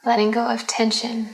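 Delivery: unhurried, calm meditation voice; guided meditation. Acted. Leads a Yoga Nidra relaxation.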